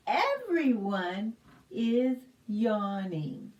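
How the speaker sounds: usual speaking voice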